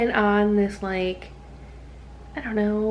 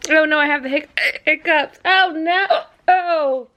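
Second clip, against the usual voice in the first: dismayed, higher and louder oh no; startled outburst; surprised; 5 semitones higher, 5 dB louder than usual. Real, not acted. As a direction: caught by hiccups, alarmed. Sudden hiccups.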